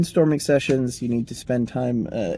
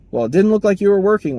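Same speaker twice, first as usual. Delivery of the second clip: higher, louder, fast retort; snippy boss; 8 semitones higher, 7 dB louder, faster than usual. Retold or imitated. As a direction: fire back like a dismissive manager. Manager retorts.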